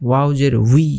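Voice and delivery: "উই" as wi with a little air; airy w glide. Shown as a demonstration. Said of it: "বাতাস একটু বের হবে".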